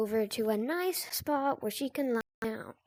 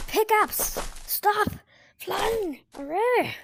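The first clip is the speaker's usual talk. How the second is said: higher, louder excited calls; excited cheer; 7 semitones higher, 3 dB louder, slower than usual. Real, not acted. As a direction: cheer the pickaxe on. Pickaxe flying away.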